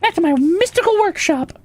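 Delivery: bubbly cartoon elf; cheery elf voice. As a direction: squeak it like a giddy workshop elf.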